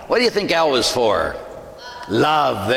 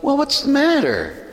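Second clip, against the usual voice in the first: concerned pastor; 12 semitones higher than usual. Acted. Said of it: "and he goes".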